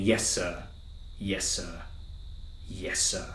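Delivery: low, quiet, spaced-out soldier's replies; imitating tense soldier. Soldier answering his general's orders, imitated quietly and tensely. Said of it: "same line with a different tone three times".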